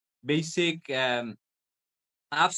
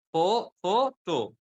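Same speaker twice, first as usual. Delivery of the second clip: slow, separated "for, photo", pitched up; stress on "photo"; 4 semitones higher, 4 dB louder, slower than usual. Shown as a demonstration. Teacher showing the stressing point, emphatic slow "photo". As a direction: stretch out "photo" as the stressed part. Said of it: "Photo is the stressing point".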